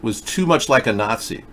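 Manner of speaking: normal talking voice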